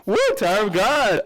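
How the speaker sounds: loud, high hype shout; excited cheer. Real, not acted. Excited cheer at show name.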